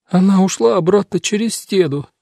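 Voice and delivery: high, plaintive character voice; sobbing. A narrator's reading. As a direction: tell it through acted sobs. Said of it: "всхлипывая, поведала Карен".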